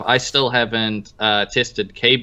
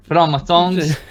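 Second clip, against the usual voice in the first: higher-pitched attempt at Aussie; lightly put-on Australian accent; mock Australian accent; 6 semitones higher, 4 dB louder than usual. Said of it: "trying to do an Australian accent".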